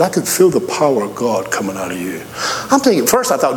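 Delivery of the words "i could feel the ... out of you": put-on a lightly deeper voice for the old man; old gentleman voice. Old gentleman on the subway telling the preacher, lightly deeper voice. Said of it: "He said".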